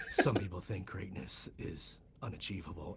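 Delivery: very low, hushed mock-epic voice; cocky self-narration. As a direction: intone it like a self-important athlete's hype track. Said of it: "he's, like, so cocky too".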